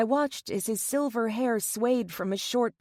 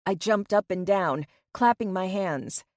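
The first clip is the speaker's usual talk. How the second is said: usual speaking voice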